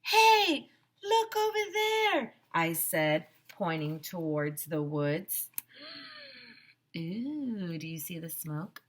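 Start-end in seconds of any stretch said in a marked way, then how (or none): 0.0-2.4 excited call. Acted. Spotting smoke in the woods. 5.8-6.3 sudden startled intake of breath; sharp high gasp. Acted. Child sees smoke rising from the woods, gasps.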